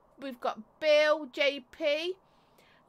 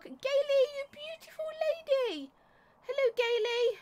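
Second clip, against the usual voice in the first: much higher, squealing hello; high-pitched greeting; 11 semitones higher than usual. Real, not acted. Streamer squealing hello to a favourite friend Gaily, high-pitched. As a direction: squeal her name up high. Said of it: "my voice went a bit high pitched".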